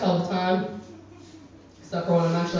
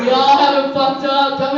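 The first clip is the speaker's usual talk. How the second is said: loud scolding; 6 semitones higher, 8 dB louder than usual. Real, not acted. Scolding the audience loudly.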